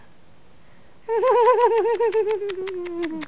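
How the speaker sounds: long happy giggle; giggly tickle attack. Real, not acted. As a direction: laugh along while playing. Laughing while playing with baby.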